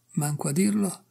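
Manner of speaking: usual speaking voice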